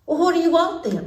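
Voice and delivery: high snippy princess; impatient princess. Acted. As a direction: demand it like a spoiled princess. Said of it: "the princess asked".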